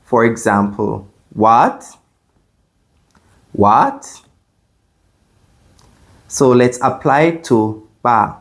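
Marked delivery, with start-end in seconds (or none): 1.3-2.0 "What" sliding sharply upward; "What" climbing in pitch to the end; rising question. Shown as a demonstration. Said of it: "like you're asking a question". 3.5-4.2 "What" sliding sharply upward; "What" climbing in pitch to the end; rising question. Shown as a demonstration. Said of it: "like you're asking a question".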